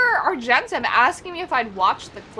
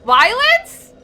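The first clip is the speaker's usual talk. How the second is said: mock shocked question; 11 semitones higher, 10 dB louder than usual. Real, not acted. Mock surprise at chat.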